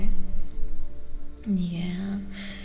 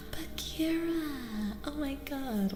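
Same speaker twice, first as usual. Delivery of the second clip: high, drawn-out surprised exclamation; gasp, then draw the name out high; gasping surprise; 7 semitones higher than usual. Real, not acted. Delighted recognition of a name coincidence.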